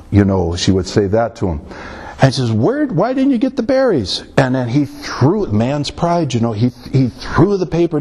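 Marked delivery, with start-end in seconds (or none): 2.5-4.2 lightly voiced wife, pitched high; high-pitched imitation of a wife asking her husband; film wife's question. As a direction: raise the pitch as the nagging wife. Voicing wife scolding in film scene.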